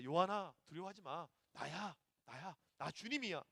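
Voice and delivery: soft, low, unhurried comfort; hushed, gentle voice of the Lord; tender reassurance. Acted. Jesus touches frightened John and reassures him, tenderly.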